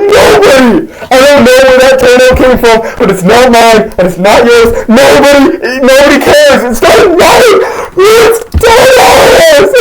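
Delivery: high acted rant building to a shriek; exasperated outburst. Host insists nobody cares about toenails, mock-hysterical. Acted quarrel reaches frenzy.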